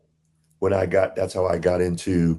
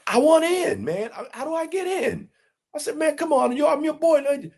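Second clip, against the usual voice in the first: much higher, pleading voice; eager begging. Retold or imitated. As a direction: beg to be let in, wound up high. Narrator retells eager plea.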